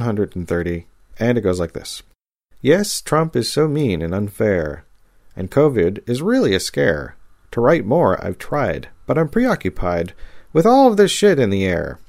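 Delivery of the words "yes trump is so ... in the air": sing-song limerick reading, building louder; bouncy rhyming couplets. Acted. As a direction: recite it with a bouncy rhyme beat. Reads a rhyming poem aloud.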